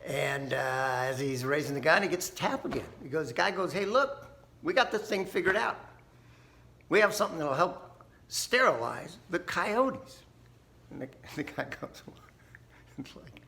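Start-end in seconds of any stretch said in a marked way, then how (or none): 3.7-10.2 a lightly voiced pushy official, higher and louder; eager government man. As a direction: sell the fix like an overeager bureaucrat. Government guy pitching his fix.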